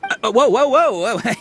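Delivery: alarmed whoa. Acted. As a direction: hold on there, hurried whoa whoa. Stopping Paimon doing something rash.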